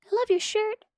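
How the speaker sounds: high, tiny creepy voice; little peanut voice. Retold or imitated. Telling the peanut joke, the nuts flatter the man in tiny voices. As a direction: squeak it like a tiny hidden peanut. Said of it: "this little voice going".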